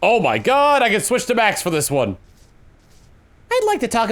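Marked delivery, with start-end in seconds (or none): 0.0-0.8 exasperated outburst. Real, not acted. Player exclaims at the chatter.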